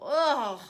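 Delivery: loud spluttering ugh of disgust. A narrator's reading. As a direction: spit out a vile taste. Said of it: "she spluttered".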